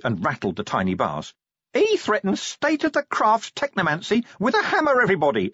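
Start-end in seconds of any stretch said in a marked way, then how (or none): 1.7-5.5 much higher indignant imp crying out; the imp complaining to everyone around; higher indignant imp shout. Acted. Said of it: "it shouted".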